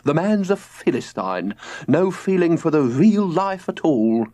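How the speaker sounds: lofty George. A narrator's reading. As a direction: sneer gently at the philistine. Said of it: "said George".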